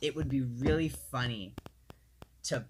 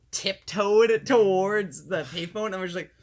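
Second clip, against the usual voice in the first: laughing; 6 semitones higher, 12 dB louder, slower than usual. Real, not acted. Laughing retelling of tiptoeing.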